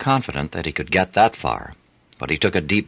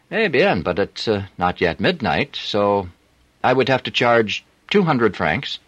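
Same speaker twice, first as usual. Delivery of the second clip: put-on higher voice with a French accent; a flirty French streetwalker; 5 semitones higher than usual. A narrator's reading. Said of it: "unmistakable French accent".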